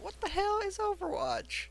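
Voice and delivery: put-on very high, squeaky kid voice; put-on falsetto of a puzzled child; Little Billy. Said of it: "Little Billy sitting".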